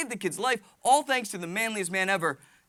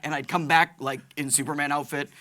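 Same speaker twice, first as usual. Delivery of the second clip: ordinary talk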